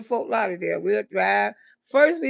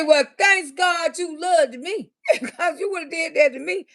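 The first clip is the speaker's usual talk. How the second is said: laughing exclaim; 4 semitones higher than usual. Real, not acted. Laughing at the story's end.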